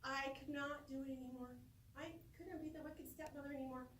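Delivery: usual speaking voice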